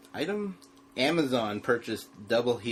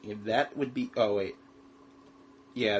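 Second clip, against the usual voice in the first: ordinary delivery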